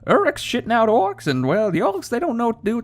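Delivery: high, squeaky comic voice; silly high voice. Pretend. Gamer joking about orcs in a squeaky high voice. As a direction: keep the goofy falsetto going.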